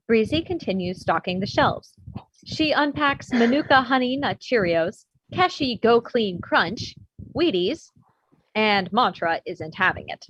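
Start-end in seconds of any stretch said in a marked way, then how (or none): none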